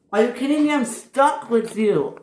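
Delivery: high-pitched disbelief, then slowing; exasperated. Acted. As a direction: whine it high in dismay. Percy dismayed at being stuck.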